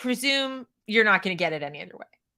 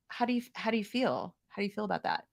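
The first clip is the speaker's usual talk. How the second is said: hushed concerned asking; 3 semitones lower, 9 dB quieter, faster than usual. Retold or imitated. Voicing solemn caregiver.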